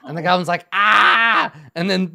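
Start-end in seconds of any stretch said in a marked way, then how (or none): 0.7-1.5 high, loud goblin cry of pain; a hurt goblin squealing; goblin yelp. Retold or imitated. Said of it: "you hear a goblin yelp".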